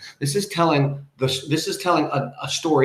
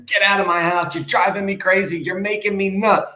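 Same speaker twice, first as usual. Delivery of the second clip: mock freaking out; 8 semitones higher, 3 dB louder than usual. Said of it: "now he's freaking out".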